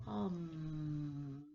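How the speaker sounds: low humming buzz, lips closed; bee hum. Shown as a demonstration. Teacher demonstrates the bee breath: low hum. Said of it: "we're going to say".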